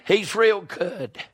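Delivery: full-force preaching. Acted. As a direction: preach it out to the whole church.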